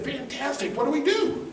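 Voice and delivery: high-pitched, rising excitement; eager enthusiasm. Retold or imitated. Patient answering the doctor's diagnosis, eager enthusiasm. Defiantly upbeat reply to diagnosis.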